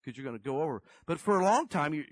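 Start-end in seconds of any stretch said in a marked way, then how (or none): none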